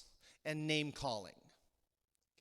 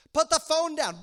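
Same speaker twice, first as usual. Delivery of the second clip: loud, high-pitched snapped order; exasperated shout; 9 semitones higher, 10 dB louder than usual. Acted. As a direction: snap at a partner glued to the phone. Fed-up partner snaps.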